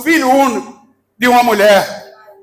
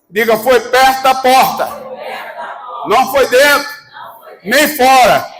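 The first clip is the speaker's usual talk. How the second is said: fiery preaching. Acted. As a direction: preach it full force to the crowd. Preacher fires up congregation.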